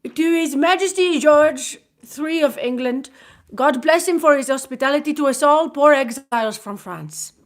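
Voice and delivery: put-on young French vicomte toasting in a French accent; broken English, French accent. A narrator's reading. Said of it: "said in broken English".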